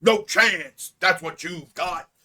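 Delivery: strut out growling like a wrestling boss; hammed-up Vince McMahon catchphrase. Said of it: "strut out the door like Vince McMahon".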